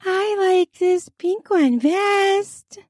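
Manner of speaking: very high, sweet, drawn-out voice; sugary high-pitched girl; sweet girl's voice. A narrator's reading. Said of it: "We hear a sweet voice".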